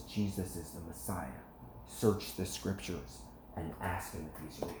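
low, hushed, earnest retold appeal; gentle witness. Wife urging the touched seller to seek, soft and earnest. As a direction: imitate a wife softly sharing her faith.

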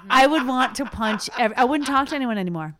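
usual speaking voice

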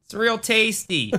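high, drawn-out Wisconsin monster voice; Wisconsin accent. Acted. Monster luring people to scare them, Wisconsin accent. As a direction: put on a slow, high-pitched Wisconsin accent. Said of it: "sound like in a Wisconsin accent".

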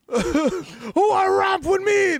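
high, slow cartoon shout; mock shout. [name] challenging everyone to rap, high slow shout. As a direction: call out a rap challenge in a squeaky holler.

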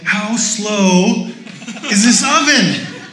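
high, loud mock frustration with laughter; exasperated rant. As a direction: pastor playing his impatient self at the oven, loud and exasperated. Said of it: "And I'm like".

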